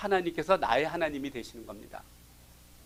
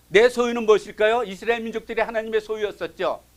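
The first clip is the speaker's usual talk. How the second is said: ordinary talk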